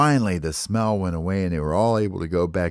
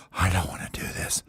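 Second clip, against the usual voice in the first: hushed dread; 6 dB quieter than usual. Acted. Baby bird holding his belly while others sleep, hushed dread. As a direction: whisper it so nobody wakes.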